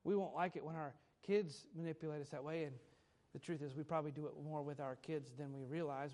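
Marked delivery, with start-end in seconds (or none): none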